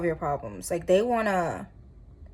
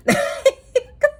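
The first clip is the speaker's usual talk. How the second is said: high, loud burst of laughter; 6 dB louder than usual. Real, not acted. Tarot reader amused by her own reading, laughing. Amused aside after a compliment.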